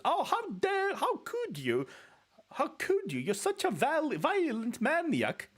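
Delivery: very high, loud scandalized voice; mock outraged parent. Streamer voicing an appalled parent in the game, shrill mock outrage. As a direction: shriek it like a horrified mother. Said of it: "or something like that".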